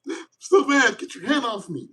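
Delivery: high indignant pout; upset partner. Retold or imitated. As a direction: snap back like a sulking lover.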